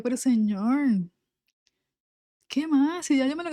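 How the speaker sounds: queja cansada, mucho más aguda; cansada y quejosa. Retold or imitated. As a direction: reclamo soñoliento de quien no quiere levantarse. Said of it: "el cansancio estaba hablando". (ella, cansada, le reprocha a Dios, aguda y quejosa)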